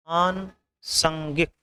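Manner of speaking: आनुसांगिक slowly in two parts, aanu, sangik; slow dictation of आनुसांगिक in pieces; broken-up correct form. Shown as a demonstration. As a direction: split आनुसांगिक with a pause before sangik. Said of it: "अनुसांगिक होता है".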